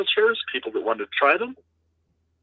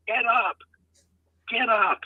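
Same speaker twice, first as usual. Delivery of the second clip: retold yell; 9 semitones higher, slower than usual. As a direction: imitate her yelling at a player, held back.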